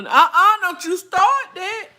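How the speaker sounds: high, loud mama scolding; imitating mama. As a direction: do mama telling you off. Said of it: "That's how my mama".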